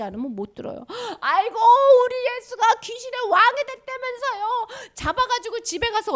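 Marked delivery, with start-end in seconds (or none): 1.2-6.1 높은 목소리로 한탄하기; a high, worried lament; lamenting family. Acted. 가족이 소문 듣고 한탄. (높은 목소리로 걱정하며 한탄)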